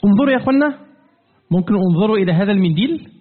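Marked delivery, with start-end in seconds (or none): 0.0-0.8 calling out. Real, not acted. Teacher calls class to look.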